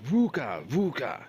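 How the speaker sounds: high, loud dwarf cheer; whooping it out at the table; excited yell. A narrator's reading. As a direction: shout the winning call twice. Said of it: "stood up excitedly and yelled".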